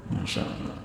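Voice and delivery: a hushed whisper of wonder; awed whisper. Real, not acted. Preacher to congregation, hushed awed whisper. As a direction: breathe it out, voiceless, in awe. Moved by the Prophet's tears.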